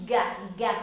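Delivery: soft, low "Gá, gá" with a hard g, not an h; syllable demo. Shown as a demonstration. Teacher to children, showing the syllable "Gá". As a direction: model "Gá, gá" quietly for the kids, hard g sound. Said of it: "parece com o som do H, mas não é H".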